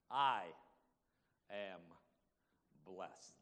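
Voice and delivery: word by word, long pauses between; measured reply, each word set apart; deliberate answer. Acted. Acts the sufferer's deliberate answer.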